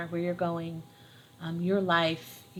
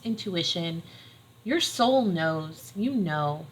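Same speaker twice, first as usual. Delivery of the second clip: usual speaking voice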